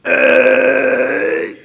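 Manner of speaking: high, loud hammed-up drunk ABCs; drunk recital. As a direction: play the sloppy drunk at the sobriety test, pitch climbing. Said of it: "here's how I did 'em".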